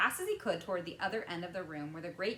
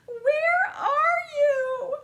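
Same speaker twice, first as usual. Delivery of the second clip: very high, very loud, slow call; desperate mother calling; 16 dB louder, much slower than usual. A narrator's reading. Mother calling for her lost son, very loud. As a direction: wail it into the room.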